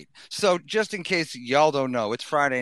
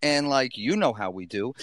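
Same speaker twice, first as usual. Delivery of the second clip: ordinary talk, nothing marked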